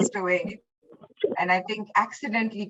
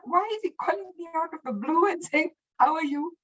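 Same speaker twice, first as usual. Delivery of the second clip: puzzled inner thought; 7 semitones higher than usual. Retold or imitated. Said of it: "And I thought".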